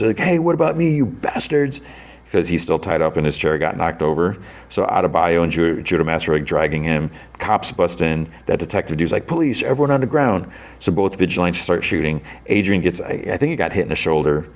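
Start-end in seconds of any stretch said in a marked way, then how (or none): 0.2-1.8 high-pitched mock yell; retold yell. Tied-up man left behind, high mock yell. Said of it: "Economos yells". 9.3-10.5 mock police command. Retold detective bursts in shouting.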